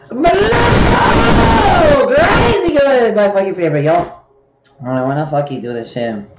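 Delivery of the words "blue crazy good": high, drawn-out yell; enthusiastic shout. Real, not acted. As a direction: stretch it into a high excited shout. Shouting about favorite drink.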